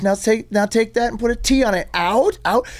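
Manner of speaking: very high, mocking director; exasperated director's lesson, imitated; berating director. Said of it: "got in my face and was like".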